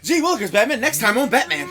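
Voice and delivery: old cartoon narrator. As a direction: ham up a vintage cartoon promo, laughing. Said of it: "It's like".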